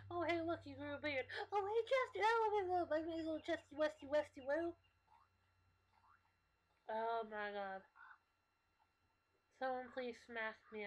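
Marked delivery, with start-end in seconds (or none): 0.1-4.7 put-on high, loud baby-talk cooing; over-the-top excited cooing; gushing pet talk. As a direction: squeal pet names in a silly high voice. Gushing over Chester with pet names.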